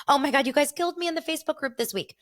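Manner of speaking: high, fast gushing to the group; thrilled outburst, real; excited. Real, not acted. Excited shout to fans.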